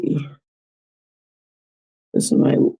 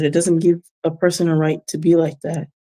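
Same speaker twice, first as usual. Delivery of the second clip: ordinary talk, nothing marked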